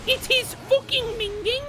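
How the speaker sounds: put-on high, hammy British accent; overdone British slang; bad British accent. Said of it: "really bad British accents".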